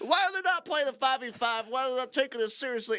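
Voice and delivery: very high, mocking complainer voice; chat voice. Caster mimics chat griping about five v five, high mocking voice. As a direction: imitate an indignant chatter way up high. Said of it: "This is what chat sounds like to me".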